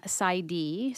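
normal talking voice

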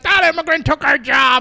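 lightly voiced angry local; mild mock yell of an angry local; mock shout. Friend imitating a complaining local, mild mock shout. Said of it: "They'd be like".

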